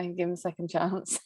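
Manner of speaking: ordinary talk, nothing marked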